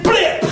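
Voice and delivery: very high, stretched blip; impact noise. A narrator's reading. Storyteller imitates the head butt landing, high blip. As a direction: pop out a high cartoon hit.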